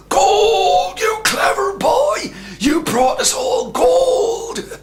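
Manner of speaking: high, loud, slow cry of joy; thrilled mother. A narrator's reading. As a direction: shriek with delight.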